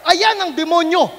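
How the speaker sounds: loud proclaiming healer. Retold or imitated. Faith healer loudly announcing the demon. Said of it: "Sabi ng faith healer".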